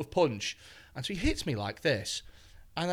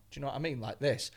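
normal talking voice

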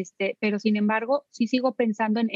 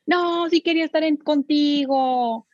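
vocecita aguda y lenta de niño que ruega; niño suplicando; 6 semitones higher than usual. Retold or imitated. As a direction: imita al niño lloriqueando. (voz aguda de niño que suplica)

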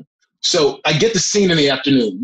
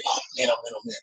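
acted-out whispered worry; hushed dread; 8 semitones higher, 10 dB quieter, much faster than usual. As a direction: whisper it to yourself, dreading what comes. Acts out own anxious thoughts.

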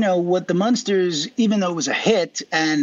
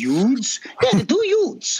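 put-on higher Brooklyn accent on "youths"; 6 semitones higher, much slower than usual. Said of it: "my cousin Vinny".

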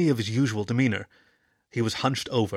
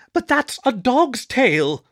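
much higher, louder, slow pup; pup retort; 12 semitones higher, 7 dB louder, slower than usual. Acted. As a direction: blurt it like a cheeky pup.

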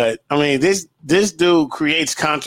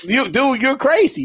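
high-pitched mock disbelief; imitated incredulous reaction; 7 semitones higher than usual. Everybody telling the player he's crazy, mock incredulous. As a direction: quote them in a raised, incredulous pitch.

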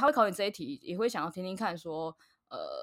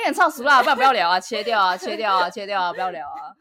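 laughing protest; 3 semitones higher, 12 dB louder than usual. Real, not acted. Co-host begging to cut the bit, loud playful protest. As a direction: call out urgently over the laughter.

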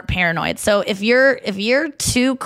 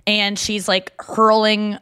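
ordinary talk

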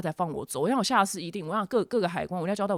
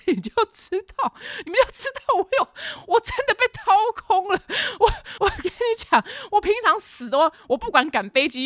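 much higher, louder, half-crying wail; exaggerated exhaustion; 14 semitones higher, 4 dB louder than usual. Real, not acted. Telling listeners she was wiped out, high crying wail. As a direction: wail about being totally drained.

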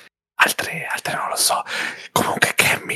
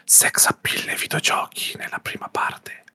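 whispering. Real, not acted. Host intro, whispered.

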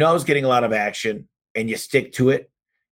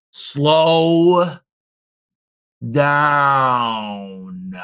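drawn-out slow down. Shown as a demonstration. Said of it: "I want you to go as slow as possible".

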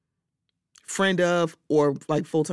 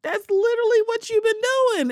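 mocking retort; 3 dB louder than usual. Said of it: "I'm like".

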